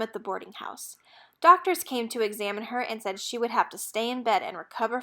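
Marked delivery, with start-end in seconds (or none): 0.0-1.0 whispered narration. A narrator's reading. The narrator whispers Annie's return to her room. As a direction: read this line in a breathy whisper.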